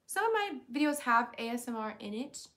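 ordinary delivery

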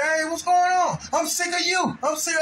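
high, shouted complaint, imitating her; imitated furious rant; mock angry yell. Wife storming out, quoted.

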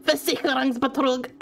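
badly faked angry German; mock angry German. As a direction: spit the word out in pretend rage. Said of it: "I sound like a drunk German".

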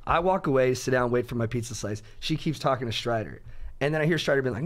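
normal talking voice

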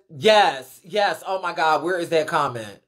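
a hint of an excited fan agreeing; reading Gunner's comment. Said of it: "Gunner says".

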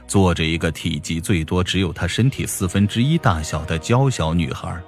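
ordinary delivery, nothing marked

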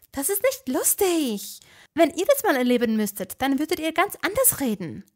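high angry voice; angry Hermine. A narrator's reading. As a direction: scold them hotly. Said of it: "verließ Hermine wütend".

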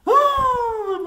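long, very high "ooh"; high loud whoop. Real, not acted. Player reacts to a hard level, high whoop. Relief or shock at level end.

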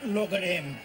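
usual speaking voice

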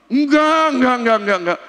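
high, loud repeated no; emphatic denial. Retold or imitated. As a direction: insist firmly that he stays. Rector emphatically refusing expulsion.

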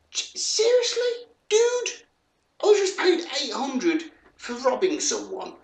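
high, loud disbelief; stunned, voice shooting way up; incredulous. Real, not acted. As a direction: squeal it in amazement. Incredulous at generous in-game payment.

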